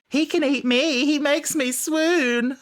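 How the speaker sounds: high, slow, dreamy swoon. Retold or imitated. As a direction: gush like a smitten fan. Quoting a swooning fan.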